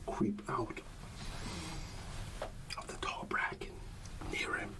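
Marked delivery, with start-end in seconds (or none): none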